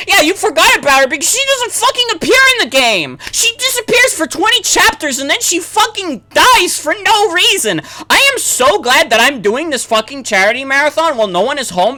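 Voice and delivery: high, loud furious yelling; screaming. Real, not acted. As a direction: scream it out at the game. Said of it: "I am so screaming right now".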